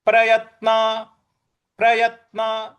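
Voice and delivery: प्रयत्न with the त struck firmly; प्रयत्न, त given a clear push; stress on त. Shown as a demonstration. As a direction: hit the त in प्रयत्न. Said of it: "य के बाद जो त है उस पर हमको आघात देना".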